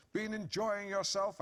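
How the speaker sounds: enjoying leaned on, drawn out slow and high with double meaning; heavy emphasis on enjoying. Retold or imitated. Husband accusing wife of an affair, enjoying stressed with heavy innuendo. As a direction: stretch out enjoying so it drips with insinuation. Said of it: "with loads of emphasis so that it was quite clear that enjoying might have a double meaning".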